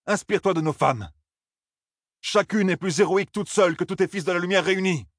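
high, taunting challenge; scornful young leader. Acted. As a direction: shame the officer into staying. Perrin goads Bornald.

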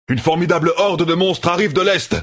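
urgent, high-pitched shout; crying out an alarm. A narrator's reading. Messenger galloping back to camp warns of a monster horde, crying out. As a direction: call the alarm out high, without much extra volume. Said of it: "cria-t-il".

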